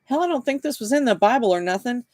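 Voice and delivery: high-pitched, unschooled true believer; confused believer. A narrator's reading. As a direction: insist on it in a high, simple voice.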